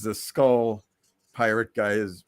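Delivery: ordinary talk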